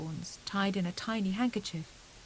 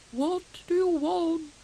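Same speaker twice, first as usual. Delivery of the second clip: slow, higher, louder hoot of a demand; owl calling; 9 semitones higher, 5 dB louder, slower than usual. Acted. Wise Owl calling down to Hedgehog, slow and loud. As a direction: call down grandly from the tree. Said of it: "called Owl".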